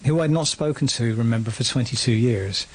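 ordinary talk, nothing marked

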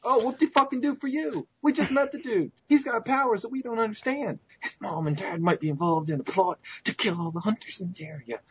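scornful sarcasm at the player; sarcastic rant. Acted. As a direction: sneer at the reckless choice.

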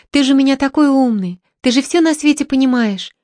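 high, pleading daughter; coaxing plea. A narrator's reading. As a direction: sweet-talk her father into agreeing. Daughter coaxing her father.

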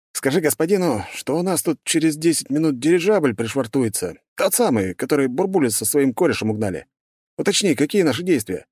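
radio report. Acted. Ostapov reports to base.

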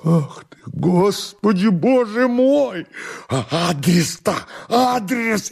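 high, drawn-out anguished exclamation; despairing lament. Acted. Master despairs at servant's answer, high anguished lament. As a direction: wail in dismay, climbing very high.

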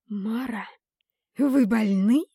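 sharp hissed retort at a calm woman. A narrator's reading. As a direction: hiss it through irritation. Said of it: "прошипела я в ответ". (прошипела со злостью)

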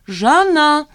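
high, loud, drawn-out call. A narrator's reading. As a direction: call her name after her. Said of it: "позвал её Ахенбаум".

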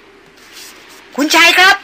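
a loud, drawn-out high call. A narrator's reading. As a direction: call slowly across the storm. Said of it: "พรานใหญ่ร้องเรียก".

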